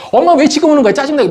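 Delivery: put-on high, whiny teen complaining to mom; mock whiny teenager; annoyed whine. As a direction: snap at mom like a bratty kid. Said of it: "막 이러면서 해서 하더라고".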